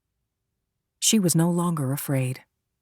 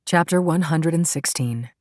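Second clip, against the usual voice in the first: plain reading voice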